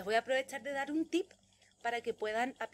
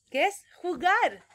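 excited; 7 semitones higher, 10 dB louder, slower than usual. Real, not acted. Teacher excited about favourite game.

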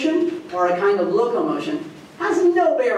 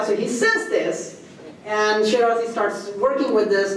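ordinary talk, nothing marked

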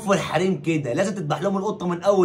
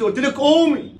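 exasperated angry order; 6 semitones higher, slower than usual. Acted. Husband repeats order angrily.

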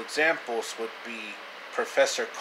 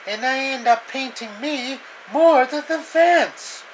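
high, whiny complaining cartoon voice; 10 semitones higher, 4 dB louder than usual. Cartoon character griping about repainting the fence, high whiny voice.

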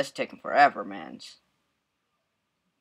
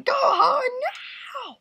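a high, hammed-up scream for his son; half-joking scream at the son; mock scream. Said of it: "he starts screaming to his son".

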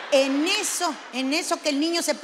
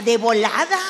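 loud burst; 3 semitones lower, 8 dB louder than usual. Real, not acted. Punchline, burst of loudness.

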